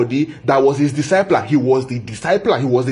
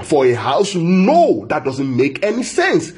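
ordinary talk

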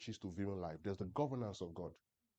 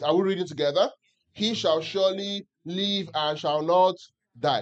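slow, louder unison reading, every word spaced; louder group scripture reading; 4 semitones higher, 10 dB louder, slower than usual. Acted. Preacher leading the church in reading Ezekiel aloud, slow and louder. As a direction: read it out together slowly, in unison. Congregation reads verse together.

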